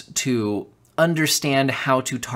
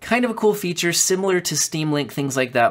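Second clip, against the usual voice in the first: ordinary talk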